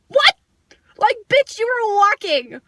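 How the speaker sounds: high, loud indignant outburst; worked-up storyteller's angry comeback; outraged retort. Retold or imitated. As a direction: fire back in disbelief, pitch way up. Outraged retort to walking girl.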